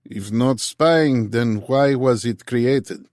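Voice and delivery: put-on slow, heavy Russian accent; thick Russian accent, drawn out. A narrator's reading. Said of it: "In a thick Russian accent".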